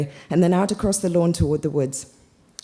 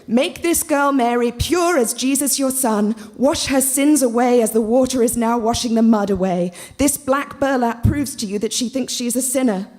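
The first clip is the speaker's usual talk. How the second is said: fervent mock prayer; 8 semitones higher than usual. A narrator's reading. Christina praying aloud in stream.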